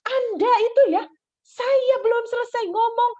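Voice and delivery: high, loud scolding outburst; angry examiner. Retold or imitated. Examiner scolds student for cutting in, angry. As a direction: imitate the examiner's indignant, high-pitched telling-off. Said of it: "sampai dia marah kayak gitu".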